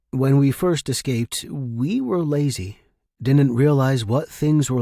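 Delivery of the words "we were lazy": ordinary delivery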